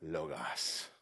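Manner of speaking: low, hushed lofty Logos; mock a snotty philosopher intoning the word; snooty philosopher. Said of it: "They would say".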